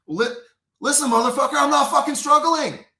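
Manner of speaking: high, loud outraged yell; furious shout. Retold or imitated. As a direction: scream it at the cop who won't stop. Yelling at the officer, retold.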